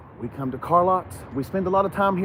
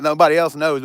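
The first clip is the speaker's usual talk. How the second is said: normal talking voice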